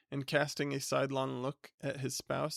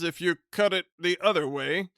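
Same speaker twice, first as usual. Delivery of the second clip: flustered, high, loud stammer; polite stammer; 3 semitones higher, 7 dB louder than usual. Acted. Flustered bachelor greeting his unwanted cousin with a stammer. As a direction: stumble over the greeting, flustered. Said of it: "interrupted Minns".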